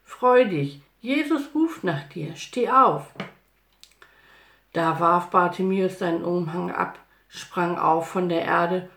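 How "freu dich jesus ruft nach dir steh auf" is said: higher, excited encouraging call; raised voice, cheering him on. A narrator's reading. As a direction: urge him up with good news. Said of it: "riefen sie ihm zu".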